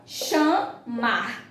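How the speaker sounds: "chamar" slowly split into cha, mar; two separate beats on "chamar"; syllable by syllable. Shown as a demonstration. Teacher giving the two-syllable answer, "chamar" split apart. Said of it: "Dissílaba".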